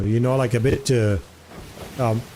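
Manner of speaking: ordinary talk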